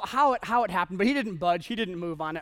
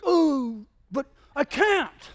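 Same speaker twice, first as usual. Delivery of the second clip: whiny protest; 7 semitones higher, 6 dB louder, much slower than usual. Retold or imitated. Whining against honoring his father.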